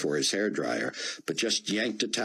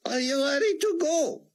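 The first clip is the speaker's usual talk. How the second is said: put-on a frail old rabbi straining through a thick Hebrew accent. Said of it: "came the thick Hebrew accent".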